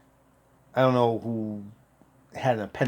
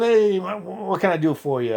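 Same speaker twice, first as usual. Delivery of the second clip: retold cheery offer, pitched up; cheerful; 8 semitones higher than usual. Cheerful Marine offering help to the patient, upbeat. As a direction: open high and friendly, then ease down. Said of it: "He's all cheerful".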